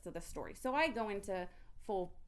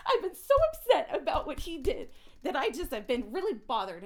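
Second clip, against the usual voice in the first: emotional outburst; 6 semitones higher, 10 dB louder than usual. Real, not acted. Outburst about being traumatized.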